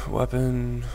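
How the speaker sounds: ordinary talk, nothing marked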